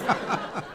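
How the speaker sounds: a short laugh, pitched high; amused chuckle. Acted. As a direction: burst out laughing at the joke. Laughs at his own joke.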